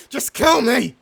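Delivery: loud anguished plea to be killed; desperate shout. Acted. Beaten captain begging his captor to kill him. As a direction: throw the demand out in despair. Defeated captain begs death.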